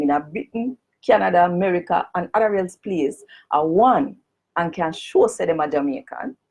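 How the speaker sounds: formal petition read in broad Jamaican patois. Shown as a demonstration. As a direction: read it out in full Jamaican Creole. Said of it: "I'm gonna read it first in a the Jamaican language".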